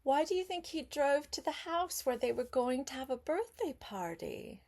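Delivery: ordinary delivery, nothing marked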